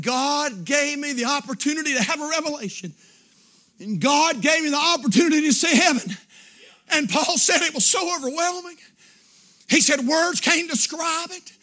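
emphatic pulpit retelling, gathering force; fervent, punched revival phrases; impassioned preaching. Acted. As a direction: build back into full-voiced preaching.

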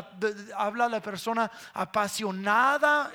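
normal talking voice

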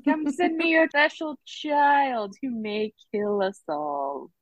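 grandly drawn-out plea, falling at the end; Professor X. Acted. Mentor asking a father for his special child, hammed-up. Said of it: "like Professor X with the X-Men".

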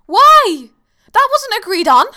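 high, loud, indignant protest; mock outrage. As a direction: play up the betrayal, loud and high.

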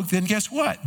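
usual speaking voice

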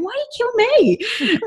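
a brother's mock-hurt laughing question; laughing protest. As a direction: brother laughing, protesting his sister's choice. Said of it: "her older brother's laughing, going".

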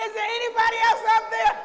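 high, fast, very loud appeal; shouting like Jack. Acted. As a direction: holler upward for a second opinion. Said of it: "See, I'm like, Jack".

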